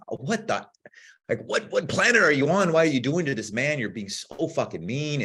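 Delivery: high, exasperated mock yell; mock screaming. Viewer scolds a mean contestant on TV, mock screaming. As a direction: imitate scolding someone through the TV.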